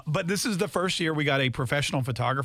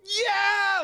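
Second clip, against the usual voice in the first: gleeful shout, pitched way up; excited cheer. Retold or imitated. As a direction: whoop like a lottery win. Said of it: "we were like".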